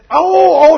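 loud, high "oh" of sudden pain; mock cry of pain. Man knocked to the floor by pain, mock cry. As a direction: howl as if suddenly struck, hammed-up.

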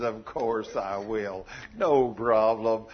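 low, slow, drawn-out reassurance; forgiving wife. Acted. Wife answers husband's apology, easygoing forgiveness. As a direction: grant forgiveness slowly and easily.

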